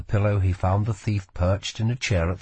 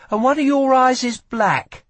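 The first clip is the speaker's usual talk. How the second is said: put-on higher, sharp young woman's voice; sharp retort; 5 dB louder than usual. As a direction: fire it back like a wary thief. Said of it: "she shot back".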